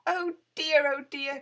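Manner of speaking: high, loud dismay; distressed cry. A narrator's reading. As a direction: wail in worry. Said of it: "cried Winnie".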